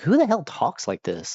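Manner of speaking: lightly exasperated question; mock incredulous. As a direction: ask it in disbelief. Acts out his baffled thought.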